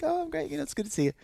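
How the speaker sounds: very high, sweet polite voice; mock her cool politeness; imitating the girl's polite brush-off. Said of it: "And she's like".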